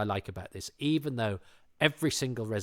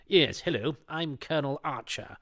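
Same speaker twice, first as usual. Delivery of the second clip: higher-pitched colonel caricature; clichéd army colonel; 5 semitones higher, 5 dB louder than usual. Acted. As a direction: put on a stock officer's voice. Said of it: "don't play them as your cliched".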